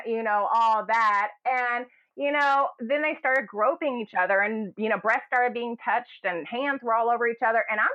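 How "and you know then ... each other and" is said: ordinary delivery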